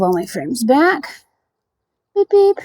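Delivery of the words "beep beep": high, piping double beep; playful beeping. Pretend. Beekeeper moving her frames back, playful high beeping. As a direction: beep like a tiny horn.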